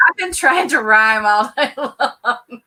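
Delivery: loud, drawn out through laughter; laughing. Real, not acted. Admitting her rhyming habit to the other host, laughing. Amused at own rhyme.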